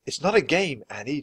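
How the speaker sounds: plain reading voice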